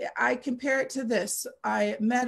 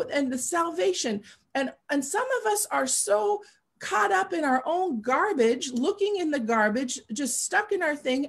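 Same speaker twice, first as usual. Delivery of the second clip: raised, higher preacher's voice, pressing the point; impassioned preaching; 5 semitones higher than usual. Acted. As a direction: preach it with rising fervor.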